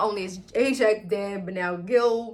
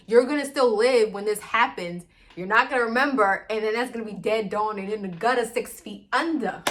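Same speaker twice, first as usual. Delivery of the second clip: loud acted argument, punching each phrase; emphatic urging; 2 semitones higher, 6 dB louder than usual. Husband urging his partner to save the world, loud and emphatic. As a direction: argue it hard and louder.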